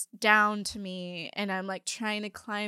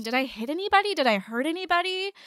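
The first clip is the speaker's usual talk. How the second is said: higher, louder worried questions; retold anxious asking; 7 semitones higher, 5 dB louder than usual. Dazed driver asks bystanders if anyone was hurt, anxious. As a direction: ask urgently, voice climbing at the end.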